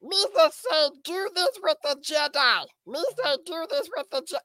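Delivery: high goofy Star Wars creature; Jar Jar Binks. Shown as a demonstration. Friend showing off a Star Wars voice, high goofy creature. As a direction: pitch it way up, cartoonish alien babble. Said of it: "you could go Star Wars".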